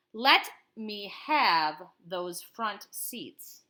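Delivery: slow, drawn-out demand; lightly voiced threatening driver; menacing driver.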